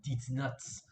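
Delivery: German accent, "Dietz" for "these". Shown as a demonstration. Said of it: "but in a German accent".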